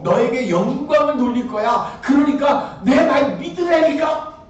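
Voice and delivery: rising, fervent sermon climax; preaching at full force. Acted. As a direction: build to a pleading shout. Climactic preaching of God's promise.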